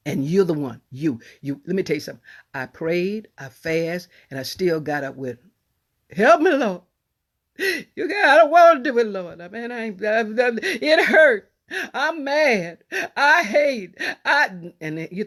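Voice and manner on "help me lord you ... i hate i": high, tearful pleading with the Lord; weeping louder prayer, voice cracking; crying prayer. Retold or imitated. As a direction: break into sobs as you beg. Retold tearful prayer to God.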